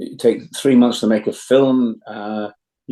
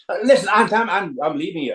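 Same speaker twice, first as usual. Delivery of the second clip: put-on higher young Jamie, stammering; higher voice, flustered Jamie storming off; Jamie's voice; 8 semitones higher than usual. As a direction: pitch up and stumble as Jamie. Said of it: "[name] was actually arguing with himself".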